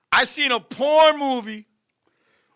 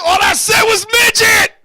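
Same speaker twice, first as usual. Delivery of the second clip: high, loud protesting shout; laughing shout; 7 semitones higher, 5 dB louder than usual. Real, not acted. Protesting to the host that he only said midget, loud and high. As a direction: burst out in disbelief.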